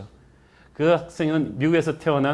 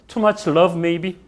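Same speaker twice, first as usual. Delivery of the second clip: very slow, higher, wry question; teasing; 5 semitones higher, 5 dB louder, much slower than usual. Acted. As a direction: drawl it out playfully.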